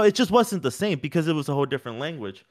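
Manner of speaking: normal talking voice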